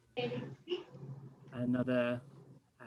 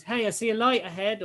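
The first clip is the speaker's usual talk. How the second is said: raised, higher call over the shoulder; a contained game master's shout; mild acted shout; 8 semitones higher, 6 dB louder, faster than usual. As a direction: call back to companions, lightly acted. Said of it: "Sin shouts over his shoulder, says".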